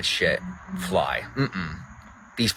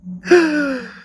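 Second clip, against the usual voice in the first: excited gasp; 4 dB louder than usual. Real, not acted. Greets Samantha, gasps.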